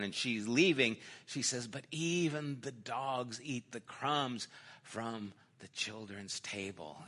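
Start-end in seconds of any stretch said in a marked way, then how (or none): 1.7-7.0 softer, lower quoted plea; humble reply. Retold or imitated. Canaanite woman answers Jesus, quietly humble.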